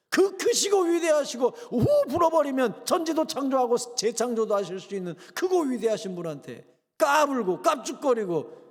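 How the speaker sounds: loud, high rebuking sermon; fiery preaching. Real, not acted. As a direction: preach hard against the proud. Preaching at full force. (목사가 교인들을 꾸짖듯 힘차게 설교)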